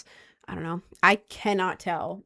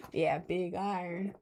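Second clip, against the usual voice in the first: put-on southern accent; 2 semitones lower, 9 dB quieter than usual. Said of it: "I don't know why that was in a southern accent".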